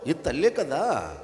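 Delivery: usual speaking voice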